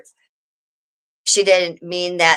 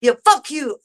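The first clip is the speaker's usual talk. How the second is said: mock angry shout; 9 semitones higher, 3 dB louder than usual. Streamer imagining cursing out her friend, mock shout. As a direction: bark it at her like a hypothetical fight. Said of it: "and be like".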